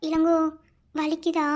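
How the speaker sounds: tender concern. Acted. Sister-in-law gently asks injured Ilango about pain. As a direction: soothe her with quiet care.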